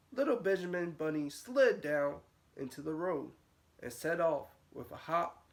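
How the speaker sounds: plain reading voice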